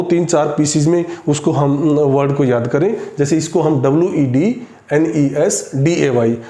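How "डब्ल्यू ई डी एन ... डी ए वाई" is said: letters grouped in threes: डब्ल्यू ई डी, एन E S, D A Y; spelling in chunks. Shown as a demonstration. Teacher breaking a long word into pieces, डब्ल्यू ई डी, एन E S, D A Y in slow groups. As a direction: spell it slowly, pausing after each group of three. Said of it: "टुकड़े बना के दो".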